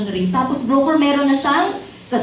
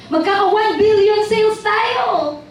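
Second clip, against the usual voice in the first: mocking boast; 9 semitones higher, 3 dB louder, slower than usual. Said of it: "sabi ng mga salesperson".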